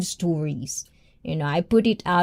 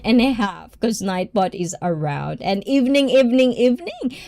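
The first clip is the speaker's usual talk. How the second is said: ordinary delivery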